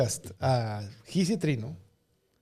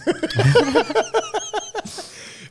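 high laugh; 4 dB louder than usual. Real, not acted. Laughing at his own bit.

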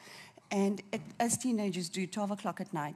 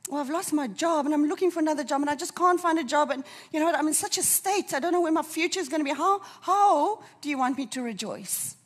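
loud, high, rising desperation; distraught protest; 7 semitones higher, 8 dB louder than usual. Acted. Preacher voices a jobless believer at breaking point, distraught protest.

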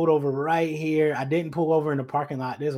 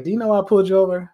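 fast, higher, louder cop question; imitating the cop; 5 semitones higher, 6 dB louder, much faster than usual. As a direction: rattle it off like a stern officer. Officer voiced in the story.

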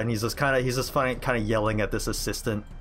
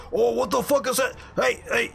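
startled warden; 13 semitones higher than usual. Acted. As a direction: yelp it out as the shocked warden. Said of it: "the warden sees this and goes".

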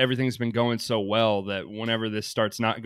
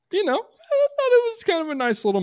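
mock sweet; 3 dB louder than usual. Real, not acted. Mock sentimental high voice.

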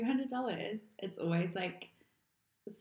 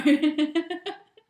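laughing; 7 semitones higher, 10 dB louder than usual. Real, not acted. Laughing at own joke.

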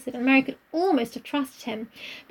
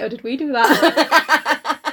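slow mock worry; much slower than usual. Mock worried question.

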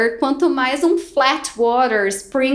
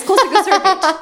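triumphant; 7 semitones higher, 4 dB louder than usual. Real, not acted. Triumphant retelling of success.

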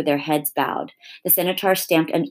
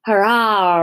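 triumphant roaring cheer, higher and louder; 5 semitones higher, 5 dB louder than usual. A narrator's reading. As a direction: roar it out like a lion. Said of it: "roared Aslan".

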